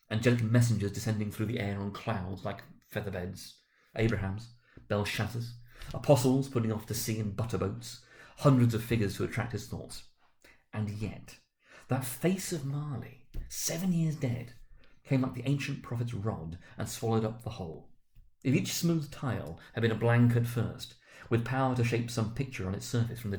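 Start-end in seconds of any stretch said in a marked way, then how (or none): none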